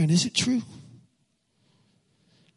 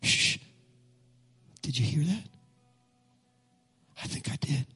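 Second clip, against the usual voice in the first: hushed, voiceless whisper; 6 dB quieter than usual. Acted. Pastor whispers to the congregation, listening for a sound. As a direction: whisper as if straining to hear.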